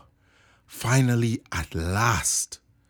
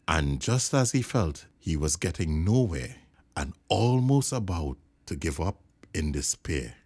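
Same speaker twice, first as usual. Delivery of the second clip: usual speaking voice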